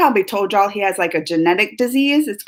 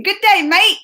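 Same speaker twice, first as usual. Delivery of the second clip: high, cheery Australian greeting; Australian accent; 8 semitones higher, 4 dB louder than usual. Retold or imitated. Streamer copying a viewer's greeting, Australian accent. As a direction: put on a playful Australian accent. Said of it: "She even did her Australian greeting".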